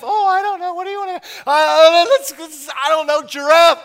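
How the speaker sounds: very high and loud; Eve, very high, searching for a name; hesitant Eve. Retold or imitated. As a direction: ponder it aloud, then blurt.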